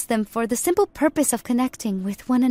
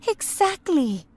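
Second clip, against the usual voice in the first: higher, delighted exclamation; pleased; 8 semitones higher, slower than usual. Acted. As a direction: say it with proud satisfaction.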